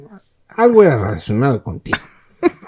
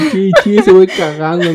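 sollozo fingido entre risas, agudo; riendo; 8 semitones higher, 8 dB louder than usual. Retold or imitated. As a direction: imita el hipo del llanto riendo. (imitando sus sollozos entre carcajadas)

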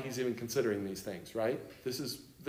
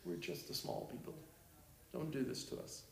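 whispered, much softer plea; pleading whisper; 17 dB quieter than usual. Retold or imitated. Amos begs God to spare his small people, whispered. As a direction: beg God in a hush.

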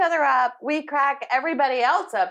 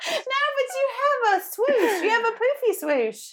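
much higher pitch, giggly insistence; laughing tease; 10 semitones higher than usual. Real, not acted. Co-host teasing him about his hair, laughing.

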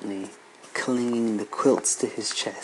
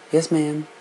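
put-on chirpy children's reply in unison; children answering; 5 semitones higher, 5 dB louder than usual. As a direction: answer brightly like kids together. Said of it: "the three replied in unison".

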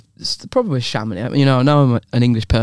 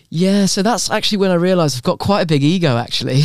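imitated English girl; 6 semitones higher than usual. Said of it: "she was like".

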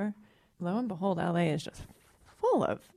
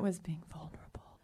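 whispering; 2 semitones lower, 12 dB quieter than usual. Real, not acted. Confiding her moment.

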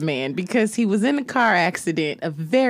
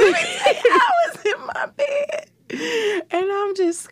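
laughing through words; much slower than usual. Real, not acted. Laughing while telling story.

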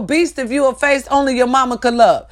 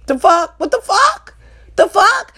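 high, rising disbelief; incredulous outburst; 10 semitones higher, 3 dB louder than usual. Real, not acted. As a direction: shriek in disbelief. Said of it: "incredulous outburst".

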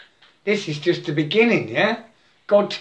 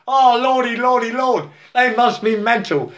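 mock pearl-clutching; 6 semitones higher than usual.

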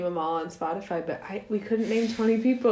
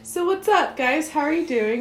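upbeat greeting; 7 semitones higher, 3 dB louder than usual. Real, not acted. Podcast host greeting.

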